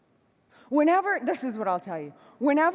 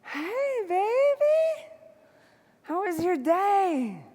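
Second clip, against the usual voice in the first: put-on much higher, sugary "hey baby"; sweet greeting; 9 semitones higher, much slower than usual. Wife greeting husband home late, sugary sweet. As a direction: coo it like a doting wife. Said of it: "and I'm like".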